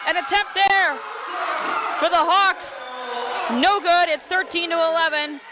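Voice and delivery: high, urgent rally call; excited play-by-play. Real, not acted. Commentator calling a chaotic volleyball rally for listeners, excited. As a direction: call the scramble as it unfolds, pitch up.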